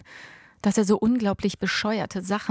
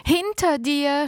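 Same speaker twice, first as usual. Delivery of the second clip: shout; 8 semitones higher, 4 dB louder, slower than usual. A narrator's reading. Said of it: "schrie ich".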